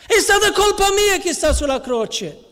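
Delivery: high, pained confession of guilt; Nicodemo tormentato. Acted. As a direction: let the guilt break out high. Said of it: "quello che stava pensando". (voce alta e addolorata di Nicodemo)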